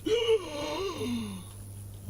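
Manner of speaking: sharp, high, breathy gasp of a man stabbed. Acted. [name] showing Peter the stab sound as a high gasp. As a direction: gasp as if knifed in the back.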